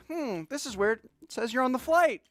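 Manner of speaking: high, puzzled staffer; airline staff. Retold or imitated. Gate agent puzzled by passenger's ticket, high imitation. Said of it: "They're like".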